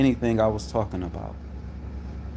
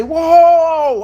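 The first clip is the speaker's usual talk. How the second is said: much higher, louder drawn whoa; loud retold whoa; 11 dB louder than usual. Him warning the dispatcher to back off, big whoa. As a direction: bellow the whoa to halt her. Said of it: "I get loud".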